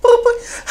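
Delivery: high squeaky boop boop; high-pitched ringtone noise; mock phone ring. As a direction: play the ringing phone.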